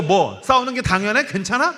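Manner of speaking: wife scolding the child, mildly put on; mock indignant. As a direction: fire back at the child in disbelief. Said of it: "그래서 아내가".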